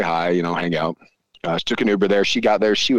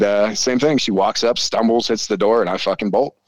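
ordinary delivery

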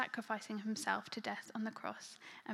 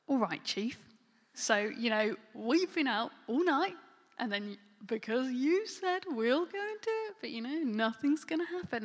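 loud, higher-pitched mock compliance; sarcastic; 3 semitones higher, 6 dB louder than usual. A tired fisherman humouring the chief, sarcastic. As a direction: humour the boss, sure it is pointless. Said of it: "quite sarcastic".

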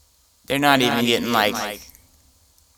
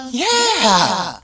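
eager viewer yes; 8 semitones higher, 6 dB louder than usual. Retold or imitated. Viewer answering the ad.

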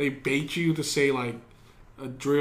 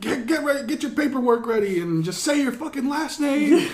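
high, raised-voice rapid orders; imitated drill sergeant; 7 semitones higher, 3 dB louder than usual. Drill sergeant to new arrivals, imitated commanding shout.

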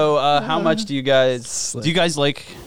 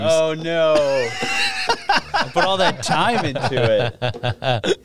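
laughing; 6 semitones higher, much slower than usual. Real, not acted. Laughing at own typo.

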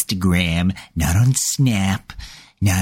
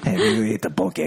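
put-on Hawaiian accent; 2 semitones higher than usual. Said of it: "Why is that in a Hawaiian accent?".

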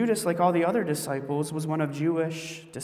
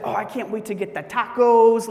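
higher, louder, gushing wife voice; super excited; 6 semitones higher, 7 dB louder than usual. Retold or imitated. Wife thrilled to be getting tacos, super excited. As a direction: gush it like an overexcited wife. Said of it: "'cause she's super excited".

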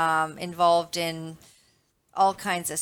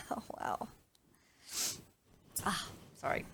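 breathless little laugh, near silent; a real, quiet laugh breaking the reading; 14 dB quieter, faster than usual. Real, not acted. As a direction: laugh it off softly under the breath. Laughing while reading scripture.

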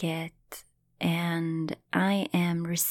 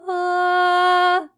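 high, loud, sustained "ah"; louder "ah"; 12 semitones higher, 11 dB louder than usual. Retold or imitated. Client answering the healer's urging, louder held "ah". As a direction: re-enact a held open cry, a bit bigger. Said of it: "And I do a little more".

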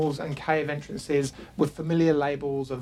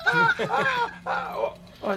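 very high-pitched comic "ah, oh" yelps; silly high noises. Acted. Game master as Blue, flickering companion, silly high yelps. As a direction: squeak out silly little cries. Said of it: "making that silly noise".

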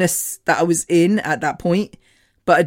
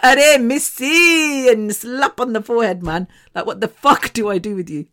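up in arms; 4 semitones higher than usual. Retold or imitated. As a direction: imitate an exasperated friend scolding. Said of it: "she's up in arms always".

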